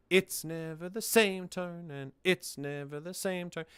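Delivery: "It's never the same tone" chanted on a steady beat; unnatural song phrasing. Shown as a demonstration. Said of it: "It doesn't flow".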